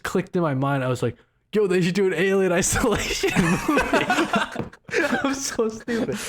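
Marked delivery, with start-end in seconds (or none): 1.5-4.1 high, laughing self-mocking quote; laughing at himself. Podcaster laughing at his own silly movie idea, high and giggly. 4.1-5.2 a high, helpless giggle. Real, not acted. Host cracks up at his own mistake with a high giggle. 5.2-6.2 high, laughing self-scold. Real, not acted. Host laughs at himself for a dumb idea, high and giggly.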